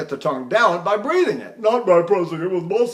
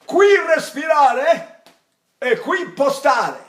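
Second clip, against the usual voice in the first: slow, high, loud Italian pronouncement; 8 semitones higher, 5 dB louder, slower than usual. Retold or imitated. Old singer telling student his teacher's rule, emphatic Italian declaiming. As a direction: declaim it grandly in Italian, each phrase drawn out. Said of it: "He said".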